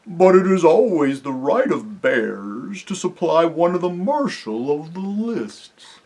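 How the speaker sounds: drawn-out, dopey creature voice; thick, sleepy bear. A narrator's reading. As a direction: insist on your right, thickly and drowsily. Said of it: "a thick, sleepy voice said".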